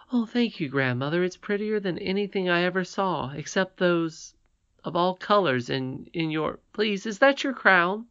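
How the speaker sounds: put-on high, eager child's voice full of wonder; a delighted little girl admiring a crown; little princess Irene. A narrator's reading. Irene marvelling to her grandmother, in a high childlike voice.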